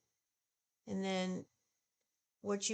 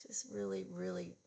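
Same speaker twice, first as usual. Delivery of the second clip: dejected sigh; 12 semitones lower than usual. Real, not acted. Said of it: "Very disappointed".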